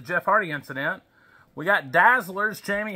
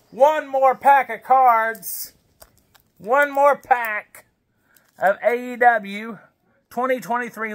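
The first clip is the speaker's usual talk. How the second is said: slow, higher and louder, drawn out; announcer build-up; 4 semitones higher, 3 dB louder, slower than usual. Acted. Dad hyping the last card pack, slow and loud. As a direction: hype it like a ring announcer.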